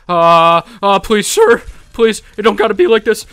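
loud, high, frantic begging; panicking plea. Real, not acted. Gamer begging an attacker to relent, panicking plea. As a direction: plead loudly for mercy in a panic. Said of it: "I'm panicking".

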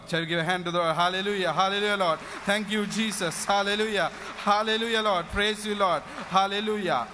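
rapid run of praise exclamations; fervent praise. Real, not acted. Preacher leading the congregation in praise to the Lord, fervent. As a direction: pour out praise one phrase after another.

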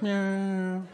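a light mock cat sound; cat meow. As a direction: meow at him, playful. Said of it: "And he's like".

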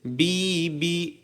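drawn-out "বি" with a long ee; slow syllable demo on "বি"; stretched zer sound. Shown as a demonstration. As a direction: spread and hold each "বি". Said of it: "এটাকে একটু প্রসারিত করবেন".

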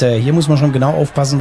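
ordinary delivery

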